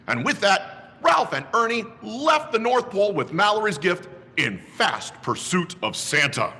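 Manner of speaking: usual speaking voice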